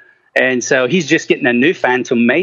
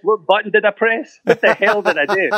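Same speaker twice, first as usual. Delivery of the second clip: higher, half-laughing bewilderment; baffled at the phone; 7 semitones higher than usual. Retold or imitated. Scolding his new iPhone, amused and baffled.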